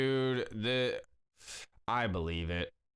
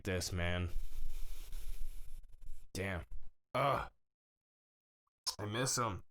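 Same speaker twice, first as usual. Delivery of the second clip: holding back tears. Real, not acted. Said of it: "I'm about to start crying right now".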